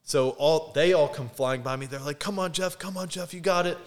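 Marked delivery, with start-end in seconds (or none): 2.2-3.8 higher-pitched cheering without real shouting; retold encouragement. Classmates running past a struggling friend, cheering him on. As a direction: imitate classmates urging a runner on.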